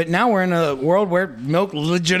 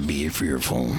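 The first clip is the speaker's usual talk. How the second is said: low, doom-laden motto; deep ominous voice; 6 dB quieter, slower than usual. Pretend. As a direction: intone it like a dark prophecy. Echoes mock tip, deep.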